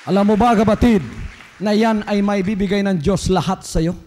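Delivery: loud preacher appeal; preaching. Acted. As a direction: call out to the brethren loudly. Tagalog altar appeal.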